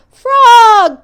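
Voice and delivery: high, loud call; boastful call to his friend; loud high cry; cried out. Acted. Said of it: "cried Toad".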